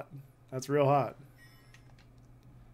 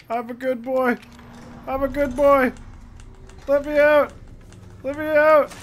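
high wailing plea, getting louder; hammed-up panicked begging; mock desperate pleading; 7 semitones higher, 4 dB louder than usual. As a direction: beg loudly to be let out. Pleads with game monster.

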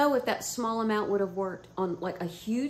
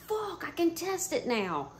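sudden idea; 9 semitones higher, slower than usual. Retold or imitated. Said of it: "And I'm like".

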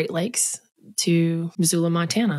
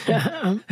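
light laugh; 5 semitones higher than usual. Real, not acted. Laughs at the bus stop joke.

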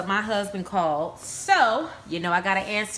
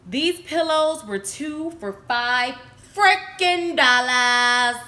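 excited; 4 semitones higher, 5 dB louder, slower than usual. Real, not acted. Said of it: "Sorry, I'm excited".

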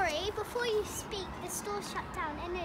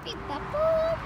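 beeping noise; 8 semitones higher than usual. Acted. Imitating phone dial.

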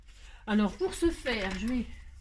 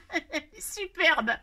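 laughing; 6 semitones higher, 3 dB louder, slower than usual. Real, not acted. Laughing at the doll's sunglasses.

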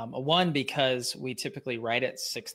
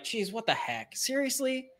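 higher-pitched, incredulous mock outrage; mock annoyed; 6 semitones higher than usual. Follower pretending to object to a repeated post, mock annoyed. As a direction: act exaggeratedly put out and indignant. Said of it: "I'm never annoyed by it".

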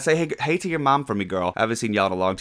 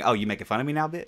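normal talking voice

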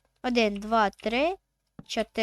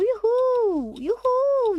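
excited whoop; 12 semitones higher, 5 dB louder, much slower than usual. Real, not acted. Excited at finding lava.

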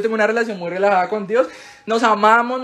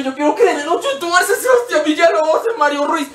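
put-on high, dragged-out kid protest; hammed-up child demanding their own way; mock childish tantrum; 7 semitones higher than usual. Same childhood tantrum continues.

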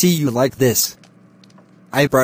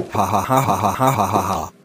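mocking laugh; 5 semitones lower than usual. Uncle laughs at nephew caught praying, mocking laugh. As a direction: laugh him down with scorn.

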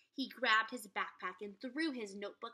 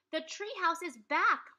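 Morgan's voice; 6 semitones higher, 8 dB louder than usual. Acted. Morgan's voice in dream.

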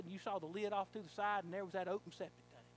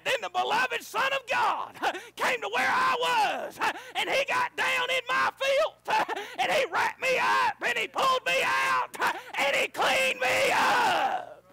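high, loud and slow, laughing through each phrase; slow laughing shout; 15 dB louder, slower than usual. Retold or imitated. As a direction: hold every phrase, shouting for joy. Preacher's testimony climax.

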